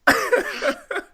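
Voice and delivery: what the fuck squeezed out through laughter; can barely get it out for laughing. Real, not acted. S1 cracking up at story.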